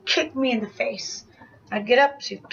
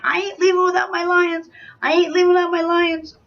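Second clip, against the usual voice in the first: mild mock scream, pitched up; retold scream; 5 semitones higher than usual. Refusing to leave the porch without the lions, retold scream. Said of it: "I'm screaming, saying".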